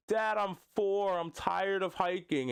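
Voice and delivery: put-on little kid. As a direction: play a tired four-year-old pleading with dad. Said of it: "you're Jon Moxley's kid".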